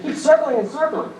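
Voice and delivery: usual speaking voice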